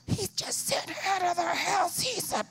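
high, mock-scandalised voice; hammed-up shocked woman; shocked listener. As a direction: gasp it like an offended listener.

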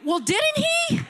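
full preaching shout; climactic shout. Real, not acted. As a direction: push the question to its peak. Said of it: "preacher's rhetorical challenge".

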